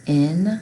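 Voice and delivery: "In-k-um" split into three loud chunks; sounded out in parts. Shown as a demonstration. Teacher sounding out a word for the class. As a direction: chop "In-k-um" into its sounds. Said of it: "Let's spell it".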